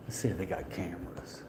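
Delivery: whisper. Real, not acted. Sneaking a look for cameras.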